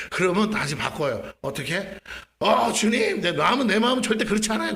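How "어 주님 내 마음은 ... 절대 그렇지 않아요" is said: high, hurried mock protest to the Lord; flustered protest. Believer backpedalling after the Spirit's scolding, flustered. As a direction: scramble to take it back, louder and higher.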